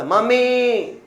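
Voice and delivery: put-on high, loud childish lisping call to mummy. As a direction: call out like a little kid who needs help. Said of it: "तुमने बोला".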